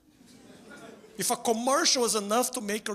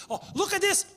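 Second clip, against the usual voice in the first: high, whiny mock complaint, pitched up; 7 semitones higher than usual. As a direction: play the bar guy ranting at the TV, high and whiny. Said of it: "The guy was screaming".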